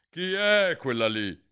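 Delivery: high, loud queen's demand; imperiosa. A narrator's reading. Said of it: "disse con voce imperiosa". (voce imperiosa)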